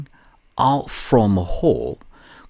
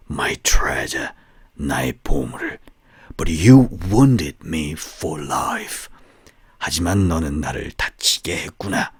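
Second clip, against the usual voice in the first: menacing snake; 7 semitones lower than usual. Acted. Snake telling the farmer he will suffer, low and whispery.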